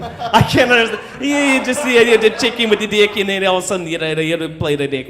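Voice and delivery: garbled imitation New Zealand chatter; mock New Zealand accent. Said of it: "whatever language they speak in ... whatever they're saying".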